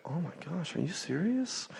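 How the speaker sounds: high, hushed disbelief; stunned kid. Retold or imitated. A stunned kid reacting to being put back on the kids' menu, hushed and high. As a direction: gasp it out like a shocked kid. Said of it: "looked at her like deer in the headlights".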